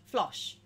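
"Flosh" with a short o and final sh; rhymes with Josh. Shown as a demonstration. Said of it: "like Josh".

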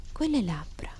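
hushed, half-whispered murmur; a grandmother murmuring over a baby; soft murmur. A narrator's reading. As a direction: murmur it under the breath. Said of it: "mormorò la nonna".

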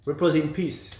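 a playful, lightly put-on English accent on "Repose in peace"; mock English accent. Shown as a demonstration. A mother joking with her kids, mock English accent. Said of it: "Avec mon super accent".

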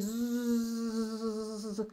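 a long buzzing zzzz like a fly. Shown as a demonstration. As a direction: hum a drawn-out buzzing zzzz. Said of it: "you find that sound".

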